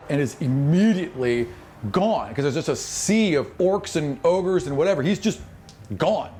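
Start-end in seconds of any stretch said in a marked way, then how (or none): none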